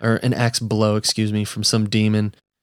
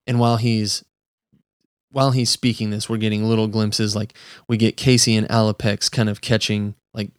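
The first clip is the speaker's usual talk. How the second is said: ordinary talk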